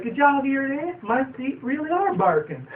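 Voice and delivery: high, slow amazed voice; surprised pony. Retold or imitated. Applejack hearing her feet bark, high and slow. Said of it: "And he's like".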